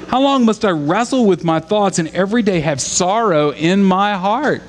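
high, pleading lament to God; a psalmist's anguished plea, pitched high; David crying out to God. Retold or imitated. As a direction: beg God to answer, voice up high. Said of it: "Imagine saying that to God".

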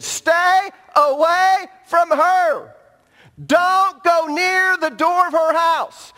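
slow, loud and high, each word hammered; preaching at full force. Acted. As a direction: thunder the warning from the pulpit. Repeats the verse preached.

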